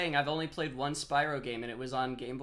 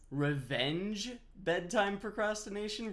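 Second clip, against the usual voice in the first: puzzled repeat; 7 semitones higher, slower than usual. Real, not acted. Puzzled repeat of a chat term.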